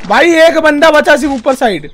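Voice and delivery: loud, high urgent call to the squad; excited callout. Real, not acted. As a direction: shout the enemy's position to the team. Teammate calling out last enemy.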